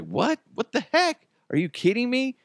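high, incredulous mock protest; imitating upset Jokic. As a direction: act out the player's disbelief at the call. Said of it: "he was just like".

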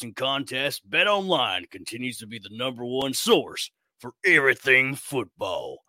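upbeat announcer promo voice; ad read. Acted. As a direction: read it like a radio ad.

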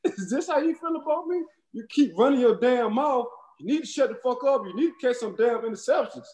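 high, wounded retold plea, breaking into laughs; pleading with an old training partner, high and wounded; mock hurt whine. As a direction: play the betrayed friend in a high voice. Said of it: "I said".

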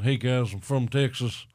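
lightly put-on Texas accent; a hint of a folksy Texas accent. Joking about visiting New York, mild Texas accent. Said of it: "I gotta do my Texas accent".

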